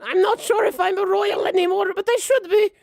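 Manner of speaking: put-on very high, loud old-woman queen; high-pitched, booming granny voice; imperious old grandma. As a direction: play a bossy grandma at full volume. Game master plays old grandma queen.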